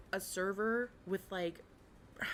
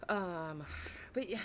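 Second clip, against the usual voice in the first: ordinary talk, nothing marked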